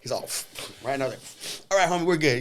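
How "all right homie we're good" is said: breezy brush-off. Retold or imitated. Brushing the guy off.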